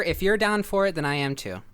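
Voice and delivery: usual speaking voice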